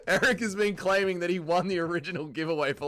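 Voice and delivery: usual speaking voice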